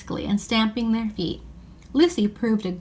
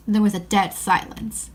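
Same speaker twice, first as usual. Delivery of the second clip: plain reading voice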